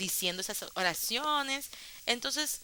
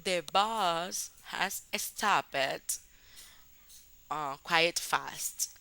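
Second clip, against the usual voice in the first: slow, careful English example; slow example sentence; 2 semitones lower, much slower than usual. Shown as a demonstration. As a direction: spell out each English word slowly. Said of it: "podemos decir". (frase de ejemplo en inglés, lenta y cuidadosa)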